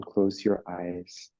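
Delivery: slow, calm meditation voice with long pauses; guided meditation. Real, not acted. Teacher settling a class into meditation, slow and calm. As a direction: ease them into stillness, unhurried.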